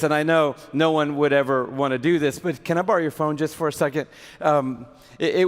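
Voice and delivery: ordinary talk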